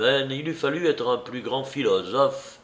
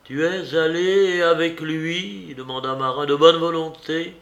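anguished question; 6 semitones higher, 4 dB louder than usual. A narrator's reading. Marin asks in anguish.

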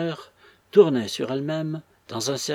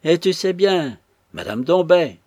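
loud, raised, impatient reminder; insistent; 5 semitones higher, 8 dB louder than usual. A narrator's reading. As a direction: press him hard with the name. Old woman badgering Robin. (insistance forte et impatiente)

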